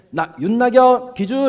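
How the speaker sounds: high, loud, slow call; drawn-out shout across the field; calling out as line marker. Retold or imitated. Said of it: "하면 어떻게 해요?".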